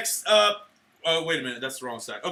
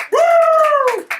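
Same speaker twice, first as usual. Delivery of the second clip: very high, loud celebratory whoop; triumphant whoop; 11 dB louder than usual. Real, not acted. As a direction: let out a big high "woo". Cheering the band's award.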